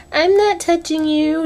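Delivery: high, taunting sing-song; annoying bratty brother. Retold or imitated. Brother taunting Angelica at dinner, high teasing sing-song. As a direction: needle her like a bratty little brother. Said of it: "just, like, asshole, annoying".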